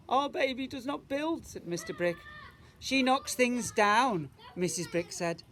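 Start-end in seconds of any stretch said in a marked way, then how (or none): 0.1-1.5 high, strained father's voice; a fretting dad in a high voice; worried father. Acted. Father's quote. 2.8-4.3 slow, loud and high; a mum at her wits' end; worried mother. Acted. Mother's quote.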